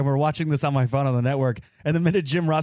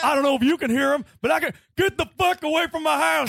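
Stone Cold; 8 semitones higher than usual. Retold or imitated. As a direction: gripe like a cranky Texan on his porch. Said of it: "He'll be like".

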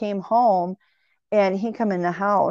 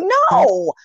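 sharp high loud no, denying it; emphatic no; 12 semitones higher, 7 dB louder than usual. Retold or imitated. Answering her husband about hitting the door, loud emphatic no. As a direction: snap back a high, loud denial. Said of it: "And I'm like".

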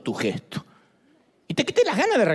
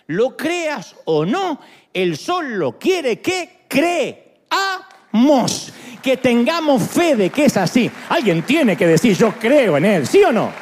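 slow and heavy, then louder and louder; fiery preaching; 4 semitones higher, 5 dB louder, slower than usual. Acted. Preacher pressing the church to declare faith, rising to a shout. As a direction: build to a full-force preaching shout.

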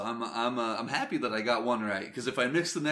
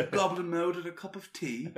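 a hint of mock British accent through laughter; laughing British joke; 7 semitones higher than usual. Teasing co-host with mock British tea line, laughing. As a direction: toss it off like a tea-sipping Brit, laughing. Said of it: "That was a terrible accent".

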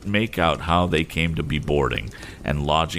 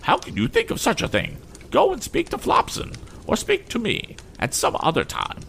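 much higher, indignant lady; haughty lady. Acted. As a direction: scold like an offended mistress. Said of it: "whose dignity was roused again".

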